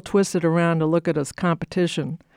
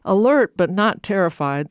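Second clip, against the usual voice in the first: ordinary delivery, nothing marked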